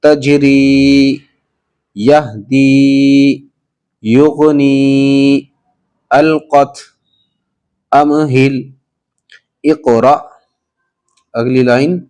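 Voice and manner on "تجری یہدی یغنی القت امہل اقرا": تجری read slowly and drawn out as one smooth word; fluent model reading. Shown as a demonstration. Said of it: "اب اس کو پڑھتے ہیں روا". (تجری کو روانی سے آہستہ کھینچ کر پڑھیں)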